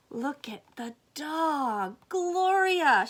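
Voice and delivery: ordinary delivery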